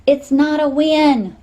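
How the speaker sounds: slow, loud, high urgent shout; girl shouting a warning over the phone. Acted. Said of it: "Nim shouted".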